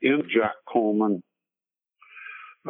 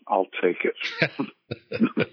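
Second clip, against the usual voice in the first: laughing; much slower than usual. Real, not acted. Joking about song being R&B.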